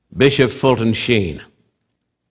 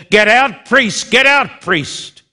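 angry shout; 8 semitones higher, slower than usual. Retold or imitated. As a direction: throw the priest out with a cry. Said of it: "blasphemed the name of God and said".

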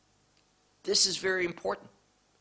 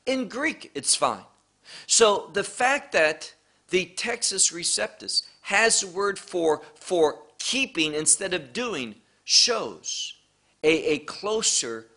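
ordinary talk

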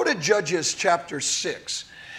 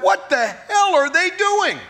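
outraged disbelief; 9 semitones higher than usual. Retold or imitated. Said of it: "I'm like".

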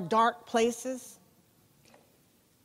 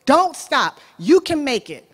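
coach yelling; 5 semitones higher, 6 dB louder than usual. Retold or imitated. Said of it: "the coach is just yelling at him".

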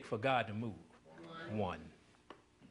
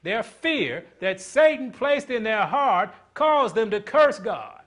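higher and louder, rolling sermon cadence; preaching at full force; 7 semitones higher, 9 dB louder, slower than usual. Acted. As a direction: preach it out to the congregation.

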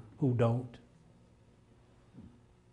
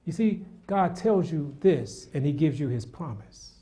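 usual speaking voice

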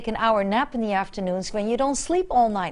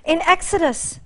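usual speaking voice

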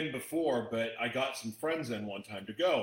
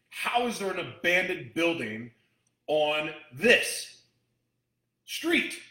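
incredulous; 3 semitones higher, 5 dB louder, slower than usual. Real, not acted. Indignant disbelief at abandoned building.

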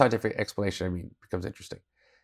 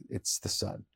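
hushed; 5 semitones lower, 15 dB quieter, slower than usual. Retold or imitated. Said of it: "they're like".

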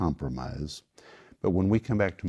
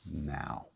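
"now" set apart after a pause, dropped low and soft; stress on "now"; 5 semitones lower, 11 dB quieter than usual. Acted. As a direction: end on a low, quiet, final "now". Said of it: "to stress the point".

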